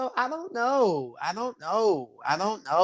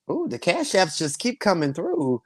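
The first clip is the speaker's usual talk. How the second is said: ordinary talk, nothing marked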